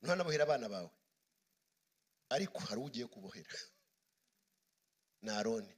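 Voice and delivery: low, soft, with long pauses; hushed preaching. Real, not acted. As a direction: drop the voice and let each phrase hang. Preacher drops his voice for suspense.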